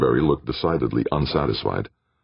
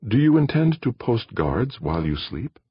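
very serious; 9 semitones higher than usual. Acted. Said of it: "Very seriously".

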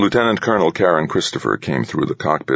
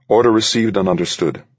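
formal radio reply; 6 semitones higher than usual. Acted. Pilot replying over radio.

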